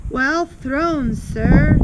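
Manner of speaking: loud, high call across a playground; shouted from afar. A narrator's reading. Onlooker cheers the throw across the playground, shouted. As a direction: call out approval, voice rising. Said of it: "somebody shouted from across".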